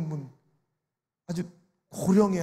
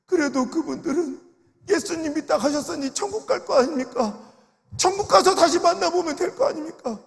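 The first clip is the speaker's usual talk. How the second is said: anguished elder; 7 semitones higher, 4 dB louder than usual. Retold or imitated. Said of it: "그분이 뭐라는지 알아요".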